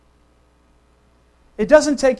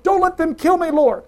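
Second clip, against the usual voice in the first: higher, louder pleading prayer; David; 6 semitones higher, 5 dB louder than usual. Acted. Preacher voices David praying in the cave, pleading. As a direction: beg for your life, hammed up. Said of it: "David's praying prayers like".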